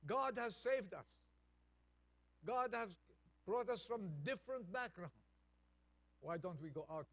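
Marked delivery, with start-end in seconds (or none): 0.1-5.1 higher, louder preaching at full force; fervent preaching. Acted. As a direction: rouse the congregation.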